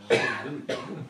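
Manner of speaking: put-on a broad Bradford accent on trouble. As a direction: say it like a Bradford local. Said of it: "Oh yes, with the Bradford accent".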